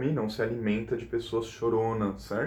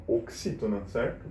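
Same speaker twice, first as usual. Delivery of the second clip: ordinary talk, nothing marked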